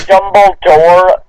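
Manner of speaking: ordinary talk, nothing marked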